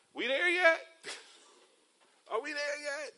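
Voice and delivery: put-on high whining child asking from the back seat; whiny kid. Preacher imitates kids whining from the back seat, high and whiny. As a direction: whine it like a bored toddler.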